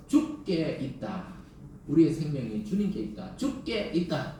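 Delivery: each syllable of 죽게 있다 drawn out slowly; slow deliberate confession. Shown as a demonstration. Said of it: "이렇게 한 날을 더 넣으세요".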